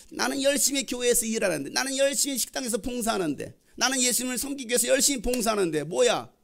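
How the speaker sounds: jealous grumbling. Acted. Martha resents Mary sitting by Jesus, grumbling. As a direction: grumble like the sister doing all the work.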